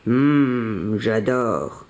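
low, lingering "hum" of delight; relishing bison. A narrator's reading. Bison is offered grilled hazelnuts, low and relishing. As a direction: savour the thought in a low beast voice.

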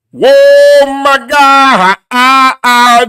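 loud, high, drawn-out gasp of disbelief; stunned shock. Acted. Reacting to the [name] slide, loud stunned shock. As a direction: react like you can't believe your eyes.

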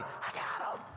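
sly triumphant whisper; preacher whispering in triumph. Real, not acted. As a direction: breathe it like a secret win. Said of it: "whispered triumph".

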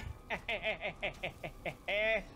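low, rapid-fire laugh; staccato laugh. Real, not acted. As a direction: laugh in a run of short even bursts. Laughs while fighting back.

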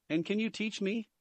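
tentatively asking a favor; hesitant. A narrator's reading. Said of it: "added Tony a little hesitantly".

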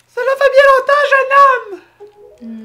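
tiny high-pitched villain voice; squeaky high voice. Pretend. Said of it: "ça serait drôle de donner une petite voix aiguë".

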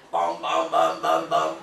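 very deep sung bam bam bass line; imitated bass notes. Shown as a demonstration. Teacher imitating a bass guitar for students, deep bam bam. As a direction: drop way down and thump out a bass guitar. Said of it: "é o som do baixo".

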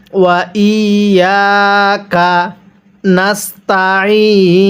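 slow, drawn-out ওয়া ইয়াকা নাস্তাইন; model recitation. Shown as a demonstration. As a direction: hold ইয়াকা and the final ee of নাস্তাইন. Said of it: "কিভাবে শিখব".

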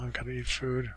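usual speaking voice

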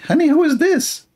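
high put-on wife voice, surprised; wife's voice. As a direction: squeal like a startled wife. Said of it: "The wife, she say".

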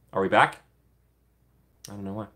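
ordinary delivery

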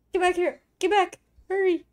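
high, loud urgent calls; panicked urging. Real, not acted. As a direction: hurry them back in a panic.

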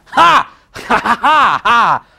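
loud triumphant ha ha ha; mock-villain gloat laugh. Real, not acted. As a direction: cackle like you just fooled them. Gloating over a fake-out joke.

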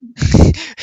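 sudden loud burst of laughter. Real, not acted. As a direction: break out laughing loudly. Laughing at a building bug.

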